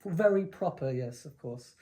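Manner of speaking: prim British accent, dropping soft at the end; proper British accent. Shown as a demonstration. Agreeing with the co-host, prim British accent. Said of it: "doing a very proper British accent".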